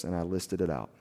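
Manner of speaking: normal talking voice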